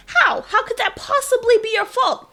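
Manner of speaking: high, loud disbelieving How; incredulous question. Retold or imitated. Listener asking in disbelief how it could be her fault. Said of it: "And you're like".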